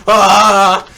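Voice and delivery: loud, high hammed-up scream; mock freak-out scream. Teen joking about tripping on drugs, hammed-up scream. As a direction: shriek as if tripping.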